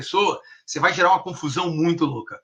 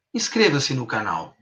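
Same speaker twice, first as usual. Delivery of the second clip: slow, lower "Inscreva-se no canal", falling away; command voice; 5 semitones lower, 4 dB quieter than usual. Shown as a demonstration. Hypnotherapist's third tone, descending command. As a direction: install it as a descending command, CANAL sinking low. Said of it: "é uma voz de comando".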